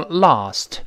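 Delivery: British broad "ah" in LAST. Shown as a demonstration. Teacher contrasts accents, LAST with British "ah". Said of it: "英国人读成lost".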